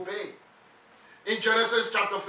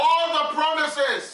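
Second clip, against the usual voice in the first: fervent preaching; 5 semitones higher than usual. Acted. Preacher calling out lines for the congregation to echo, fervent. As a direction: lift the church with each phrase.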